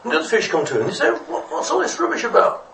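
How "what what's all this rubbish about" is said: very high, fussy challenge; indignant questioning. Acted. Other fish confronting the little fish, indignant. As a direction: demand an explanation in a piping voice.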